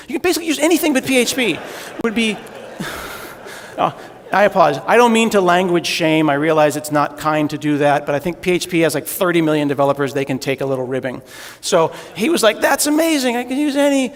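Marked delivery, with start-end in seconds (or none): 0.1-1.6 rushed joke. Retold or imitated. Ribbing PHP in retold quote. 12.6-14.1 high, thrilled voice; excited. Retold or imitated. Intel guy's delight retold.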